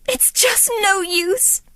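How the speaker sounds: mild tearful despair; sobbing. A narrator's reading. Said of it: "Cinderella sobbed".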